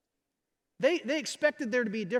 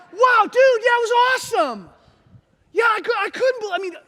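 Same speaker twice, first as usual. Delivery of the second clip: loud, high-pitched gushing; awestruck enthusiasm; 11 semitones higher, 11 dB louder than usual. Acted. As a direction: play an amazed buddy raving about a party. Villagers marvel at remembered wedding.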